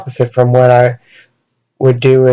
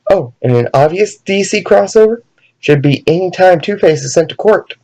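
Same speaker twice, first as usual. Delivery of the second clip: ordinary delivery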